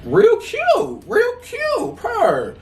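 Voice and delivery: very high, squeaky "real cute"; mock cute squeal. Showing viewers her graduation photo, high mock gushing.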